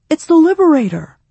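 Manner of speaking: high, loud excited cry from the crowd; excited shout. A narrator's reading. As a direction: call out in thrilled recognition. Voice in crowd cries out.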